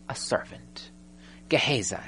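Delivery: breathy aside, dropped to a whisper. Acted. To the congregation, revealing who answers the door: whispered. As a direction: lean in and breathe it like a secret. Aside naming the lowly servant.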